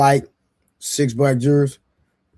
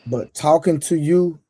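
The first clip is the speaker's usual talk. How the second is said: mock serious; 6 semitones lower, 4 dB quieter than usual. Real, not acted. Mock-serious slow put-down.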